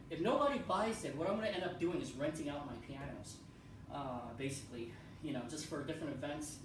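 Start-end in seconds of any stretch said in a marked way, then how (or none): none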